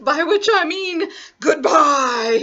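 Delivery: loud, slow, rising to a cry; menacing wolf. Acted. Wolf about to swallow grandmother, loud menace. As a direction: build to a big predatory farewell.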